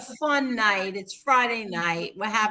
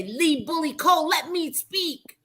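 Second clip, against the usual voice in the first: mild mock scolding; 6 semitones higher than usual. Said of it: "But I did say".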